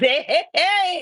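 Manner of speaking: loud, high whoop at good news; excited cheer. Retold or imitated. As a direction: cry out "hey" high and loud in celebration. Reaction to news of the wedding.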